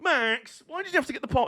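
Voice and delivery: mock a fan's plaintive complaint, lightly; dismayed fans. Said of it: "Even the Verstappen fans were like".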